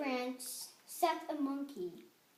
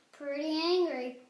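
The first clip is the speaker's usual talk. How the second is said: ordinary delivery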